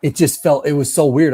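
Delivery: ordinary talk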